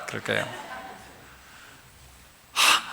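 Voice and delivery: normal talking voice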